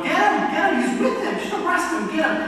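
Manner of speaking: urgent high-pitched accusing cry; man raising alarm. Acted. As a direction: call out high for an arrest. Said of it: "there's this guy that recognizes me".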